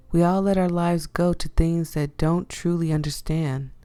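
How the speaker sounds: ordinary delivery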